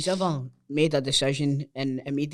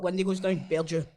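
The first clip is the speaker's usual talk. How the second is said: normal talking voice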